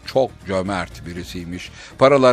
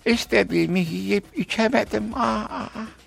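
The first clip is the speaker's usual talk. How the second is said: regretful lament; 11 semitones higher than usual. Acted. Old woman laments trapped.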